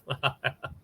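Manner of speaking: short soft laugh on cue; demo laugh. Shown as a demonstration. Teacher laughs to show what he notices, soft laugh. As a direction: laugh quietly to show the example. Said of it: "I laugh".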